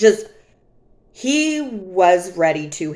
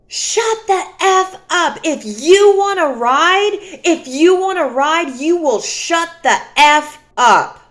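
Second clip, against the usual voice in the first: high, loud, mocking shout of a raging man; mock angry yell; 7 semitones higher, 5 dB louder than usual. Boyfriend screaming at her in the car, high furious shout.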